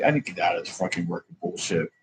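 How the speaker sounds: usual speaking voice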